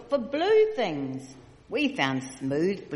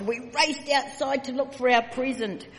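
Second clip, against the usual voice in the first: ordinary delivery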